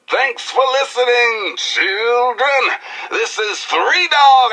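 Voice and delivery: hyped DJ sign-off. Acted. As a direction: pump up the crowd with your own name. Radio DJ sign-off.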